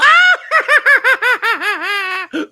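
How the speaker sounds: high, loud screeching cackle; witch cackle. Acted. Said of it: "Wicked Witch of the West".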